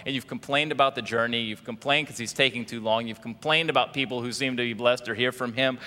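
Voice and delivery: normal talking voice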